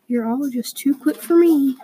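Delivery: usual speaking voice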